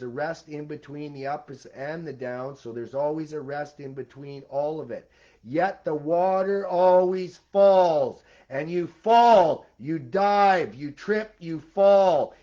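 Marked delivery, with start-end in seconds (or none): 5.4-12.3 slow, louder hammering phrases; emphatic preaching. Real, not acted. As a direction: pound each phrase home. Emphatic preaching about falling.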